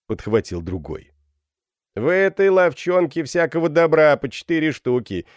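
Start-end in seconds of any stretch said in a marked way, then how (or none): 1.9-5.2 high, loud shout from the crowd; crying out. A narrator's reading. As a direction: call it out high over the crowd. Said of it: "крикнул третий". (выкрик из толпы)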